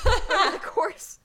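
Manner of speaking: giggling while reading; laughing. Real, not acted.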